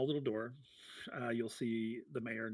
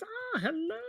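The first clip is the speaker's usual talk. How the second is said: very high, delighted greeting; Feldwin. Acted. Halfling greeting a new visitor, high and delighted. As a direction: swoop way up on the hello. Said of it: "he goes".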